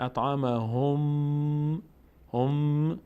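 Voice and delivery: اطعمهم with a long held m at the end; h joined to m. Shown as a demonstration. Teacher shows the doubled mim, h joined to m. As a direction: run the ه of هم into a humming, sustained m. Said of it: "این ه میچسبه به میم".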